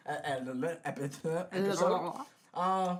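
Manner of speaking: put-on a thick Russian accent on the stumbles. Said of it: "Sounds like a Russian accent".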